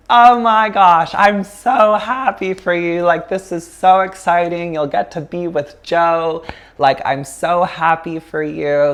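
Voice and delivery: gushing, high-pitched imitation of an overjoyed mother; thrilled mom. Mom hears daughter's big news on the phone, thrilled. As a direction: burst out delighted, then ease down. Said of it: "without skipping a beat, she's like".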